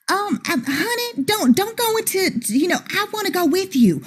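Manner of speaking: high, put-on Southern belle voice, flustered; bad Southern accent. Wife stopping her partners in a bad Southern accent. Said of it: "she had already started that bad Southern accent".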